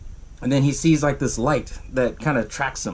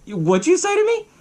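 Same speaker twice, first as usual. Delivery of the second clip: very high, offended challenge; acted pissed off. Husband taunted about his wife at the station, pissed. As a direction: imitate outraged disbelief, pitch leaping up. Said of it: "he gets all pissed".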